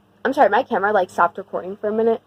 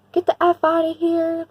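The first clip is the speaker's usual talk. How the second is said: raised, higher voice yelling an intruder off; retold yell; 6 semitones higher than usual. Neighbor chasing off a stranger in his backyard, mock yell. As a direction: bark it at someone hiding in the yard.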